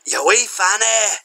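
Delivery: high, slow mock-Scottish jeer. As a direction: toss a playful insult in broad Scottish. More put-on Scottish insults.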